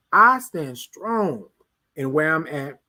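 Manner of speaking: each word planted slowly; drawn out, standing firm; slow emphatic declaration. Real, not acted. Preacher to viewers, stating it slowly. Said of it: "I'm getting kinda crunk".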